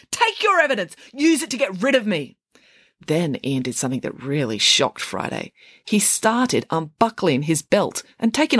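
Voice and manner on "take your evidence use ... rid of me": high-pitched, bitter outburst; angry yell. Acted. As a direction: yell in furious defiance. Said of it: "he yelled".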